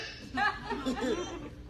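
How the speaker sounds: high amused laugh. Real, not acted. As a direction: crack up at the memory. Laughing at the memory.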